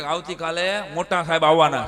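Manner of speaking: loud warning teacher; scaring the kids. Retold or imitated. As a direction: announce it to frighten the class. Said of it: "બીક બતાવતા".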